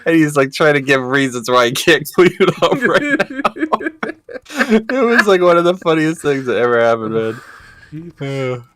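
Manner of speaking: telling it through high helpless laughter; story squeezed out between real laughs; laughing. Real, not acted. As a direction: barely get it out for laughing.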